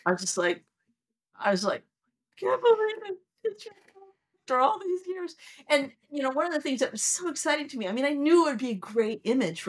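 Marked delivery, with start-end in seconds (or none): 2.4-5.3 high, choked-up wonder; tearful disbelief. Retold or imitated. Scientist seeing Neptune's rings, tearful awe. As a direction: gasp it out like you might cry. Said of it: "I started to cry".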